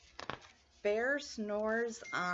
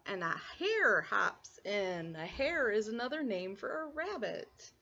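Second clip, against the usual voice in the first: ordinary delivery, nothing marked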